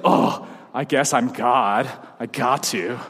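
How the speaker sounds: slow, low, grudging sigh of a voice; hammed-up weary obligation, dragged out; mock reluctance. Preacher imagining God giving grudgingly, mock reluctant.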